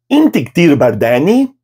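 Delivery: "Inti ktir bardani?" slow with a final rise; rising question intonation; question tune. Shown as a demonstration. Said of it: "com entonação de pergunta".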